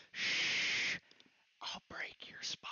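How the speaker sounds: slow whisper right into the ear; shush, then whisper a threat slowly; menacing whisper. Retold or imitated. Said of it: "got real close to his ear".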